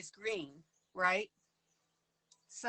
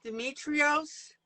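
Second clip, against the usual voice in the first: put-on slow, drawn-out Greek accent; 5 semitones higher, 4 dB louder, slower than usual. While painting, tries the name with a Greek accent. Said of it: "Did I get the, the accent right".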